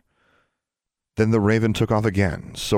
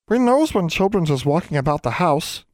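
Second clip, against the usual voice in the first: house elf voice; 11 semitones higher than usual. Acted. House elf Nina scolding a sneaking child in squeaky voice. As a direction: pipe it up like a fussy little elf.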